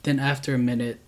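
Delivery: ordinary delivery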